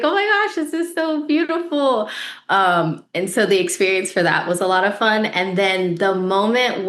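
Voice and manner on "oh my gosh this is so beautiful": high-pitched, mock-weepy gush; retold tearful cry. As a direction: act out bursting into happy tears, much higher. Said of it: "I just started crying".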